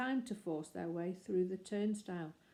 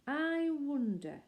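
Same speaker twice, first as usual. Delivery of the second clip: slow, drawn-out musing; thoughtful; 8 semitones higher, 5 dB louder, much slower than usual. Acted. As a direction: wonder it aloud slowly. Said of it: "looked thoughtful".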